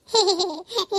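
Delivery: loud amused laugh, rising; mock-scornful laugh; hearty laugh. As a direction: burst out laughing at the silly husband. Character laughs at husband's worry.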